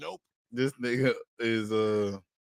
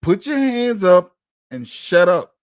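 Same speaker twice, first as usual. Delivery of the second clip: mock cop order; 5 semitones higher, 8 dB louder than usual.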